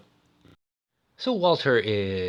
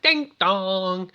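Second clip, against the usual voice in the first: sing-song high doorbell chime; imitated doorbell; 7 semitones higher than usual. Gabler at the front gate, imitated doorbell. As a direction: mimic a two-note doorbell.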